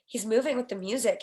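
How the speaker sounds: ordinary delivery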